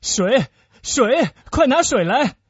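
high, loud, frantic call; panicked, urgent command; urgent shout. Acted. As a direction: shout desperately for help. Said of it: "达达尼昂喊道".

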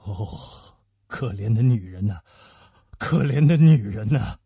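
low, pitying lament; deep voice, swelling with emotion; agitated, trembling murmur. Acted. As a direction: murmur with shaken compassion. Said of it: "用激动的发抖的嗓音低声地说".

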